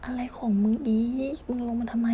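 hushed tense whisper. Real, not acted. Gamer hiding from the monster, whispering angrily at it. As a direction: whisper so the monster won't hear.

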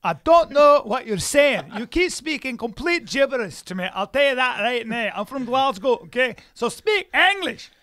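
outraged Glaswegian tirade; angry Scottish accent. Acted. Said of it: "Your Scottish voice".

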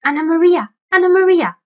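high, squeaky rat calling a name; squeaking rat. Acted. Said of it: "squeaked the rat".

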